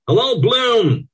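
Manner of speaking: high, loud, slow hail; loud call. A narrator's reading. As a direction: call out for all to hear. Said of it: "calls loudly for all to hear".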